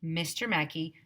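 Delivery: ordinary delivery, nothing marked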